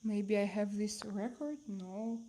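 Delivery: normal talking voice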